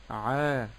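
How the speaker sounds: عا held long on the vowel; lengthened ayn. Shown as a demonstration. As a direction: draw out the ayn sound in عا. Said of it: "زدت في زمن العين". («عا» بزيادة زمن العين)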